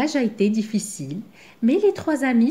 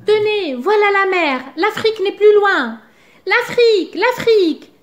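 much higher, louder, thrilled announcement; excited cries; 10 semitones higher, 5 dB louder than usual. A narrator's reading. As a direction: sing out the sight of the sea. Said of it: "les cris de Zigomar".

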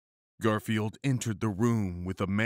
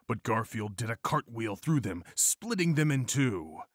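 ordinary delivery

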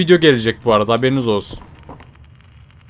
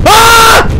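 piercing high scream; terrified shriek; 9 dB louder than usual. Real, not acted. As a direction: shriek at the jump scare. Jump scare. (tiz, dehşet dolu çığlık)

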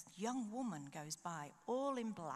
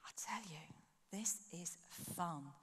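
confiding whisper; 2 semitones lower, 5 dB quieter than usual. Real, not acted. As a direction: lean in and whisper the joy. Confiding aside to congregation.

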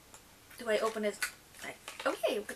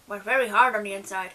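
usual speaking voice

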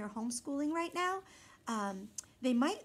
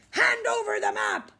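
much higher, loud order; demanding shout; 10 semitones higher, 9 dB louder than usual. A narrator's reading. Pirate captain demanding the map. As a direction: demand it fiercely.

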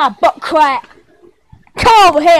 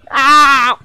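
usual speaking voice

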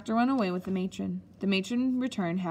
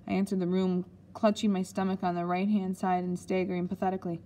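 ordinary delivery

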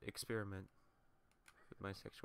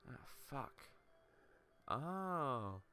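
ordinary delivery

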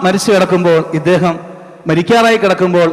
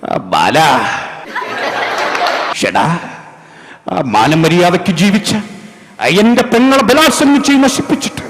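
Nazir as vengeful judge; 4 semitones higher, slower than usual. Retold or imitated. Judge confronting his sister's attacker, hammed-up vengeful speech. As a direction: thunder at the villain who ruined your sister. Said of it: "സ്ഥിരം പറഞ്ഞ dialogue ഉണ്ട്".

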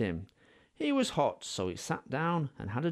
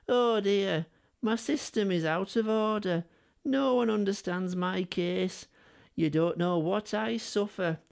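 higher, put-on moaning voice; Henry mourning; 6 semitones higher than usual. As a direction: whine like a sorry-for-himself engine. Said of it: "mourned Henry".

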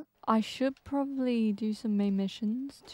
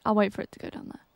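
normal talking voice

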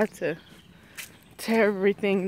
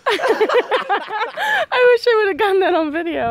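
slow, high, laughing through words; 12 semitones higher, 6 dB louder, slower than usual. Real, not acted. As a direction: laugh out the words, almost crying. Laughing hard after cat.

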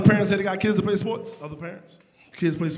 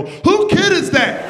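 high, slow nosy parent voice; drawn-out high gossiping parent; mocking parent; 11 semitones higher, 4 dB louder, slower than usual. Teammate's parent asking about the boy, high and slow. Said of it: "teammate parent go".

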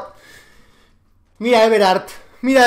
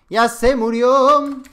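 gloating; 5 semitones higher, 6 dB louder, much slower than usual. Real, not acted. Enemy dies.

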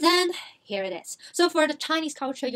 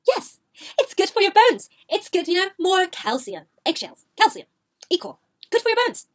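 mom voice; 5 semitones higher, 4 dB louder than usual. Retold or imitated. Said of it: "My mom's like".

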